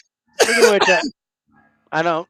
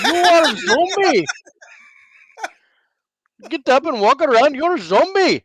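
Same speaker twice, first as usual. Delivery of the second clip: put-on Indian accent; 9 semitones higher, 6 dB louder than usual. Teasing friend mock zombie accusation.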